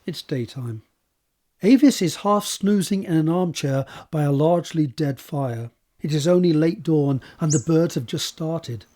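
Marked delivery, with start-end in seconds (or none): none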